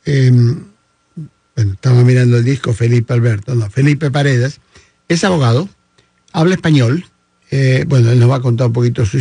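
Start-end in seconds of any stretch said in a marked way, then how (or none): none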